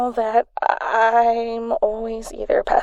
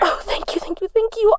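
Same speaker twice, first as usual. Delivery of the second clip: grateful gushing; 11 semitones higher, faster than usual. Acted. Friend grateful for the ride.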